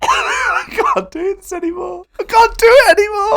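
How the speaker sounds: high, loud wail through laughter; helpless laughter. Real, not acted. Podcaster giving up mid-show, helpless laughing wail. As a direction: shout it out, cracking up completely.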